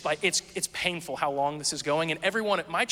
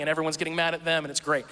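ordinary talk

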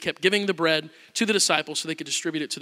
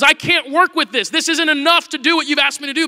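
anxious protest; 7 semitones higher, 5 dB louder than usual. Retold or imitated. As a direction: object that it's not enough. Gideon despairs before God.

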